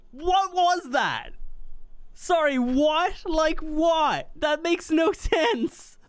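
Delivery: high, slow, drawn-out bewildered questions; baffled by the absurd death; slow high disbelief breaking into a laugh; stunned disbelief. Real, not acted. Killed again by the serpent.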